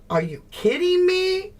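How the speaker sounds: high, amazed Are you kidding me; thrilled at a new trick; delighted disbelief. Real, not acted. Delighted by PowerPoint discovery.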